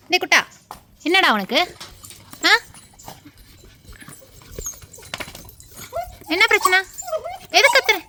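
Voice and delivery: loud, high-pitched scolding questions; scolding the dog. Real, not acted. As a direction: demand an answer from a noisy pet. Scolding a noisy barking dog.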